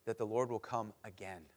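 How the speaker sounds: ordinary talk, nothing marked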